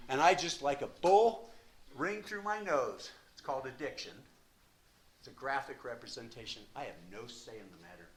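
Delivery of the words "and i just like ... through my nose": fierce sermon emphasis. Real, not acted. Passionate image of addiction.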